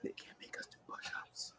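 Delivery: barely audible conspiratorial whisper; hushed, voiceless acted whisper. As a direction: whisper it as a secret aside. Retold secret thought among trainees.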